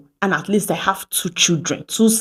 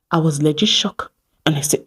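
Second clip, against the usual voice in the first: usual speaking voice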